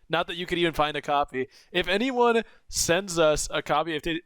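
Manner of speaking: ordinary talk, nothing marked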